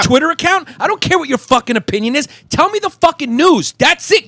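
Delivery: high-pitched, exasperated tirade; heated rant. Real, not acted. Comic telling reporters to just report the news, worked-up rant. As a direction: lay down the law, pitch pushed way up.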